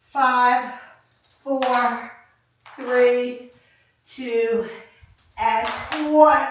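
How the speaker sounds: pumped-up coach counting down; loud countdown. Real, not acted. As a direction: push them through the last seconds. Coach counting down final hold.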